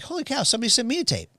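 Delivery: hurried, high-pitched retold surprise; pleasantly surprised. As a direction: act out an astonished reaction. Acting out Dave's surprise at tape.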